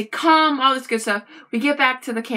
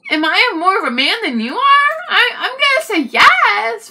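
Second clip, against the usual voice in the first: smug boast; 11 semitones higher, 6 dB louder than usual. Retold or imitated. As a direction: play it smug and self-satisfied. Boastful self-answer after rant.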